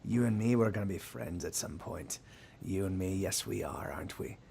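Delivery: low, very soft sailor's threat; quiet menace. Acted. Menacing sailor threatens captive elf.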